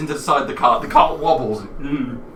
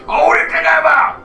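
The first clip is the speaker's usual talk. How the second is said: high, loud giant head shouting; two bickering giant voices; 8 dB louder than usual. Acted. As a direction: yell like a squabbling giant head. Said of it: "And the other head says".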